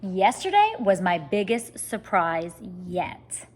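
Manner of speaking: usual speaking voice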